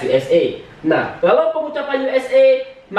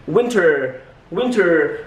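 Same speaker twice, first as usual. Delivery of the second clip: American winter with a thick, rolled R; American accent; 3 semitones lower, much slower than usual. Shown as a demonstration. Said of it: "Contoh seperti kita sebutkan winter".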